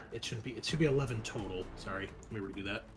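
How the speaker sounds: ordinary delivery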